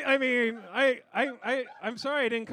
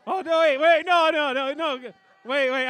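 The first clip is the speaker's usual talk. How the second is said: laughing panic; 9 dB louder than usual. Real, not acted.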